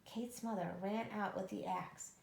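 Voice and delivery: plain reading voice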